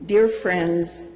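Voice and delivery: normal talking voice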